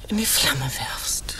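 slow hushed command; slow whisper. Acted. Wife demands he burn shell. (langsam geflüsterte Forderung)

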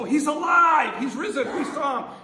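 disciples shouting the news, high and loud; excited shout. Retold or imitated. Disciples telling Thomas Jesus lives, excited shout. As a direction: burst out with joyful news. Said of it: "when the disciples go".

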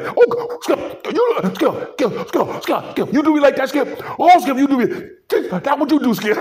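high, whining plea to Skip; whiny mock Shannon. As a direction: beg Skip in a high whine.

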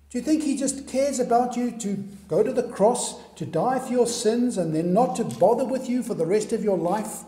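normal talking voice

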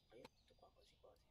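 soft whispered aside; quick whispered aside to herself. Real, not acted. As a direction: tuck it away under the breath. Muttered aside while stocking.

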